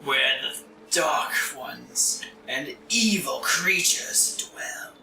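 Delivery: slow, breathy ghostly whisper; eerie spirit whisper. Acted.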